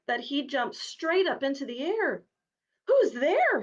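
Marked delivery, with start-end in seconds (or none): 2.9-3.6 high, loud startled cry; startled shout. Acted. Startled rabbit shouting from the bush. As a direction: shout it in fright. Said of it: "he tried to shout".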